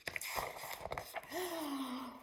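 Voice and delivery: a big storytelling gasp, drawn out; long theatrical intake of breath; dramatic gasp. Acted. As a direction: gasp in mock alarm for the kids. Dramatic page turn.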